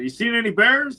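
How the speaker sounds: teasing called-out question; sarcastic holler. Retold or imitated. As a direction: holler it over to a neighbor, tongue in cheek. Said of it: "sarcastically hollered".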